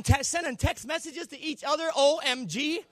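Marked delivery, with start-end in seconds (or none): 1.9-2.8 rising higher and louder letter by letter; mock gleeful texting. Philistines gloating over victory, mock excited. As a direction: gloat like a happy winning army. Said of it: "They were shouting".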